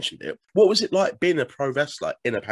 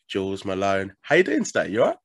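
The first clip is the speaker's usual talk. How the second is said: ordinary delivery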